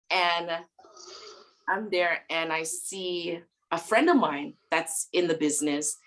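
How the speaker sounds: normal talking voice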